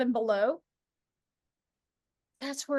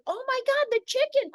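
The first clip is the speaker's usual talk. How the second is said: much higher, startled "oh my God"; imitating the roommate's sudden shock; 11 semitones higher than usual. Retelling the roommate spotting the escaping chicken, high startled gasp. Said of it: "my roommate was like".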